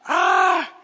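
a sudden high, loud cry of panic; panicked yell. Retold or imitated. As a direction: yelp out in mock alarm. Panicking about preaching tonight.

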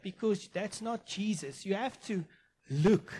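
fiery preaching. Acted. Pastor challenging his church, rising to a loud preach. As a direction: drive it home to the congregation. Preaching builds to full force.

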